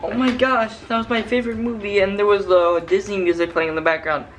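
loud, gushing excitement; excited. Real, not acted. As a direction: burst out about a favorite film. Gushing about favorite movie.

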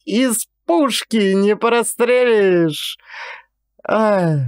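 high, slow words through leftover laughter; a lightly voiced laughing jab; still laughing. A narrator's reading. Pavel Mitrich teasing Vaska, still laughing. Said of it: "заливаясь смехом".

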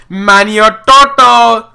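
slow, loud, drawn-out Manny Toto!; amused exclaim. Real, not acted. Gamer reads a funny place name aloud, loud and slow. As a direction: savour the odd name Manny Toto, stretched slowly.

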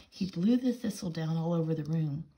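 ordinary delivery, nothing marked

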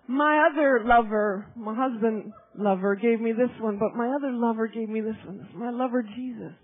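low, hushed confiding testimony; intimate, tender. Real, not acted. Preacher showing her ring from Jesus, intimate and tender. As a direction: drop low and share it tenderly.

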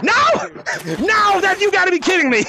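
high, incredulous protest; exasperated outcry. Real, not acted. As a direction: cry out as the catch slips away. Player escapes the tag.

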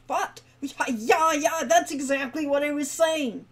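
put-on half-asleep old man caught out; startled awake. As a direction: snap awake and cover for dozing off. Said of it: "looking startled".

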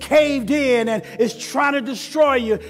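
loud, rising sermon cry; preaching at full force. Acted.

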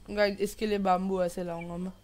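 ordinary talk

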